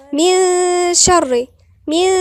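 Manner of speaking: من with a long thin nasal hum in place of n; light ghunnah. Shown as a demonstration. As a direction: hide the ن of من, humming lightly into شر. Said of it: "مرققة مش مفخمة".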